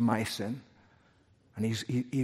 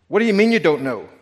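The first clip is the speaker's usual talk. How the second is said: higher, louder and faster disbelief; incredulous angel; 7 semitones higher, 9 dB louder than usual. Acted. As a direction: demand it in disbelief. Angel questioning the thief.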